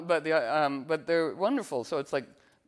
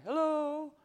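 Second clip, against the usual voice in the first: hello sung much higher, carried out; calling hello; 12 semitones higher than usual. Retold or imitated. Calling out to the universe, pitched high and hopeful. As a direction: call hello up into emptiness. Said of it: "sometimes calling out".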